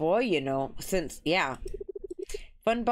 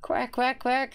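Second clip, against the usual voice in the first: playful imitated quacking, a bit higher; mock duck quack; 5 semitones higher, slower than usual.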